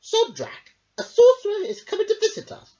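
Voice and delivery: high, loud, fast king voice; a spoiled king showing off his news; boastful king. A narrator's reading. King telling Merrick about the guest, high and quick. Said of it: "said the king".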